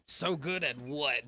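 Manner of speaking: normal talking voice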